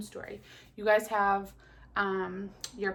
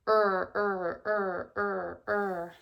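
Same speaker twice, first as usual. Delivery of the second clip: rhythmic er er rowing noise; paddling sound; 4 dB louder, much slower than usual. Shown as a demonstration. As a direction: chant the oar sound in beats. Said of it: "made this sound when he paddled".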